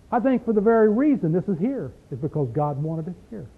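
usual speaking voice

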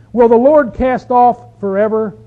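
loud, slow rhetorical question; ringing, drawn-out Bible reading; scripture reading. Acted. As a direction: proclaim the psalmist's question to the congregation. Reads psalm's questions aloud.

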